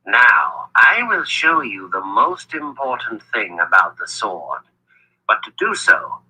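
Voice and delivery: put-on high, sly old wizard. Wizard Zed speaks.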